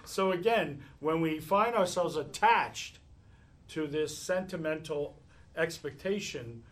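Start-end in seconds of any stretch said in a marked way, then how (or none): none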